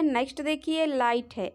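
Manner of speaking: normal talking voice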